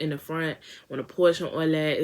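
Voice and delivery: normal talking voice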